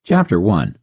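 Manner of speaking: ordinary delivery, nothing marked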